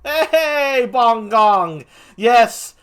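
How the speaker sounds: high, loud hail to a friend; big drawn-out hello; excited greeting. Real, not acted. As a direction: call out happily to an arriving viewer. Greeting a viewer joining the stream.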